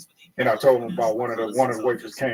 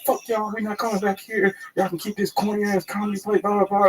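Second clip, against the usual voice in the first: higher-pitched mock cussing-out; imitating angry cussing; 8 semitones higher than usual. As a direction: act out fed-up girls cussing everyone. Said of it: "cussing at everybody".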